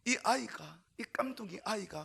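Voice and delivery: ordinary delivery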